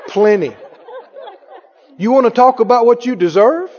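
usual speaking voice

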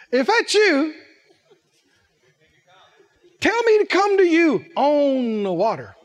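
lightly acted high call across the water; Peter calling out. Disciple to Jesus from the boat, calling out high. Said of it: "Peter said, "Lord".

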